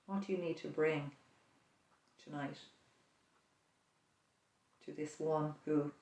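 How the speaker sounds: ordinary talk, nothing marked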